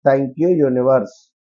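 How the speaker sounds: very slow, grateful mantra; slow affirmation. Shown as a demonstration. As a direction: draw out the thanks to the universe. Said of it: "ఈ యొక్క nine times రాసేది ఏమిటి?".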